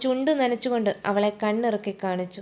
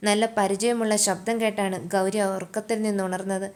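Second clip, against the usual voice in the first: plain reading voice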